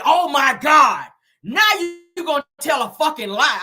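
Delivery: loud, high, exasperated outburst; mock-angry shout, stretched at the start; retold snapping. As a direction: imitate a man finally snapping, loud. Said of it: "seen Chris snap off on nobody".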